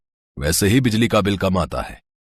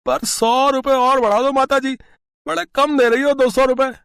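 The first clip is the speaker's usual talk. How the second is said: put-on much higher, chuckling crooked repair boy; sly young electrician, high and cocky; cheeky electrician. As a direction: sell the scam with a laugh.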